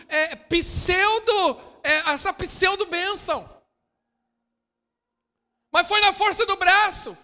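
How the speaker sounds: high, loud preaching, firing questions at the church; full-force preaching. Acted. Preacher challenging the congregation, at full force. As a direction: preach it hard, pushing for an answer.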